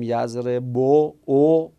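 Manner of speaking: یضرب ending in a stressed "bo", then a separate "o"; final o pronounced. Shown as a demonstration. Teacher demonstrating the visible case ending, final o pronounced. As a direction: sound out the rounded o at the end of یضرب.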